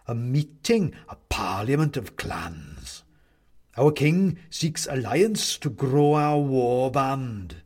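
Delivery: drawn-out menace from a headless toy; the clown answers its captive, slow and eerie; sinister goblin clown. Acted. Clown character voice.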